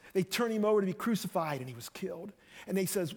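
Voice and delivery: ordinary delivery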